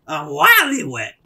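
very high, loud and slow; silly character voice. Pretend. Player riffing on the character's catchphrase, slow high silly voice. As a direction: draw it out in a squeaky mock boast.

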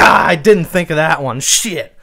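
loud mock dismay; teasing imitation of a sore loser; mock frustrated loser. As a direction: lightly act out losing to yourself. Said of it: "and you're like".

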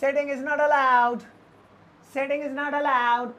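slow, careful teacher's model sentence; slow clear demo. Shown as a demonstration. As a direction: spell it out slowly for learners. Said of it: "इसे हम अंग्रेजी में बोलेंगे". (धीमे और साफ़ बोलकर सिखाना)

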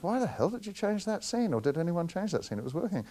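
imitating the Film Commission's indignation; indignant demand. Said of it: "their response was".

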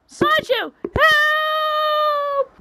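very high, long far-off scream; cry for help. Acted. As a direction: shriek his name as you're carried away. Kidnapped girl calls for help.

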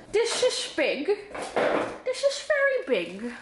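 high, slurred comic voice with S as SH; goofy high voice, mushing "this is" into "dish ish"; playful funny-voice repeat; silly put-on voice.

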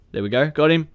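ordinary talk

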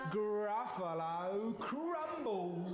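"Gruffalo Crumble" slow and drawn out; menacing relish. Acted. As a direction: savour "Gruffalo Crumble", stretching each word. Mouse threatens the Gruffalo.

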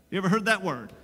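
usual speaking voice

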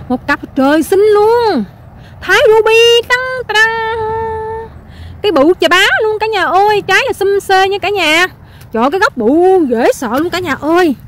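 high, loud, drawn-out excited cry; excited showing-off. Real, not acted. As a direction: sing out the big reveal, stretching the key words. Excited hawking of huge tree on camera. (hô to phấn khích, kéo dài giọng)

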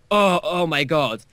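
exaggerated "oh my God" reaction; retold shock. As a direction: slowly mimic a scandalized crowd. Acts out everyone's shocked reaction.

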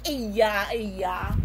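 low mock crying sounds; mock whimper. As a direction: pretend sobbing. Toy sobbing.

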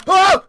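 short, high, loud shriek; startled scream. Real, not acted. Player jumping at a game scare, startled scream.

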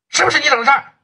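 high, pressing challenge with 不 hit hard; angry demand. Acted. Boss demanding the truth from his partner, angry. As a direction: demand it sharply, almost yelling.

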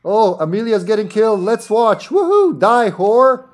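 high, loud excited whoop; gleeful cheering. Real, not acted. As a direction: cheer on the kill with relish. Cheering a character's death.

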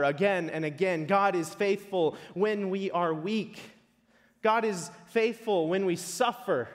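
impassioned preaching. Acted. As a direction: drive each repeated phrase home. Preacher proclaims at full force.

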